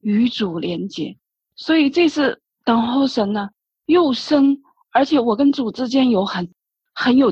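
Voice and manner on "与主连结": soft, slow, lowered murmur; silent inward cry. Retold or imitated. Believer steadying excitement while waiting on God, quiet inward cry. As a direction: say it slowly and softly, as if inwardly. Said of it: "我就在我心里默默地喊".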